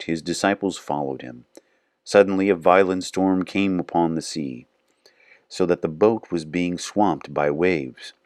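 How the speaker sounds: measured, reverent reading of scripture. Acted. Reading scripture aloud to viewers, measured and reverent.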